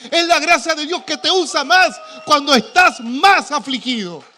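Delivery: loud, high, slow shouted preaching; preaching at full force. Real, not acted. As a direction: cry it out from the pulpit. (prédica gritada con fervor)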